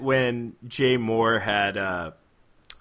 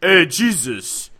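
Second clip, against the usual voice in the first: put-on Brooklyn accent; 4 semitones higher, 7 dB louder, slower than usual. Mocking Brooklyn-accent apostles.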